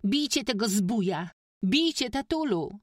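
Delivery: put-on high shrieking girl's scream; high, loud frantic shriek. A narrator's reading. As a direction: shriek at father to hit him. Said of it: "i wrzeszczała".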